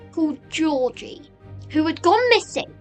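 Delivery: usual speaking voice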